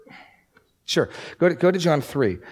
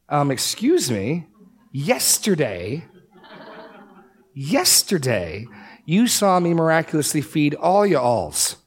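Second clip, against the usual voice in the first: slow, drawn-out mock indignation, pitch higher; sarcastic crowd voice; 5 semitones higher, slower than usual. Crowd pushing Jesus for another sign, slow mock indignation. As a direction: ham up a pushy crowd stretching out yesterday.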